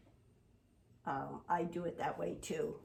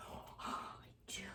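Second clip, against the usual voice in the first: breathy little whisper as the dog; 14 dB quieter than usual. Pretend. Owner voices her dog's eager answer, whispered. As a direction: hush it out like the dog agreeing.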